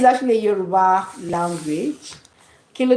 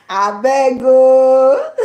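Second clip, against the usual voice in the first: loud, higher, very drawn-out cry; playful exclamation; 4 semitones higher, 7 dB louder, much slower than usual. Shown as a demonstration. Joking about viral slang, loud drawn-out playful exclamation. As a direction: belt it out slowly between laughs.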